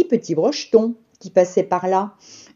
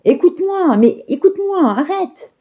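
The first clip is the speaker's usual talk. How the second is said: high pleading call; a pig calling after the pancake; 9 semitones higher than usual. A narrator's reading. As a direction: beg her to stop, pitched high. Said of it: "cria le cochon".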